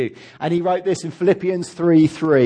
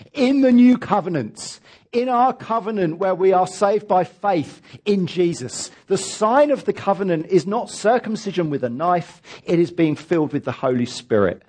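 ordinary talk

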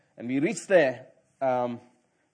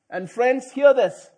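loud, higher call to attention; 7 semitones higher, 7 dB louder, slower than usual. Real, not acted. Preacher calls the congregation to listen, louder. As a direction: demand their attention.

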